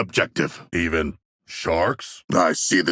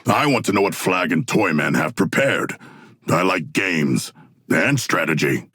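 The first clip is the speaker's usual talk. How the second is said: ordinary delivery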